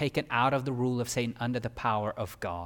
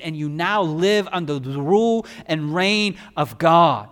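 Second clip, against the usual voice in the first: impassioned preaching; 5 semitones higher, 7 dB louder than usual. Acted. Preaching at full force.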